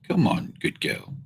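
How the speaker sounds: high breathy whisper to the dog; whispered pet talk; coaxing pet. Real, not acted. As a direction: coax her in softly.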